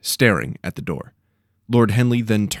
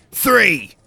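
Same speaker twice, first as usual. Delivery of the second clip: louder growl, a gruff big woman; 12 semitones higher, 6 dB louder than usual. A narrator's reading. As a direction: growl it like a stern steward. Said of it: "the Orden's gruff voice".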